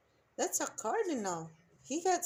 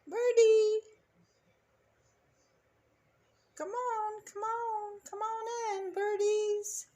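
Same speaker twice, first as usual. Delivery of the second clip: calling out; 9 semitones higher, 4 dB louder than usual. Real, not acted. Calling a bird to the feeder.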